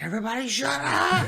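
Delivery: high, rising mock scream; retold screamed outburst. Jerry to the screaming heads and cat, mock scream. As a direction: yell it over imagined screaming, pitch climbing. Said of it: "And they're all screaming. And he's like".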